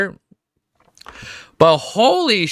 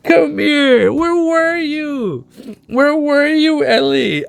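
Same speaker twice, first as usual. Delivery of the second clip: cooing to dog; 11 semitones higher, 4 dB louder, slower than usual. Real, not acted. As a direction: call the dog over in a sing-song coo. Calling his dog Ellie.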